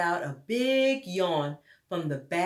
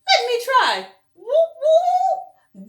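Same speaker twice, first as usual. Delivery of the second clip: very high, loud eager call with a laugh; eager bear; 10 dB louder, much slower than usual. Acted. Douglas scrambling up next to the owl, high and gleeful. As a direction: bounce up the tree gleefully.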